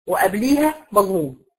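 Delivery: ordinary delivery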